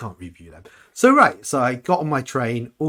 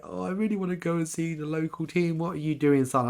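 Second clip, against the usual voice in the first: dad voice; 7 semitones higher than usual. Retold or imitated. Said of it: "my dad was just like".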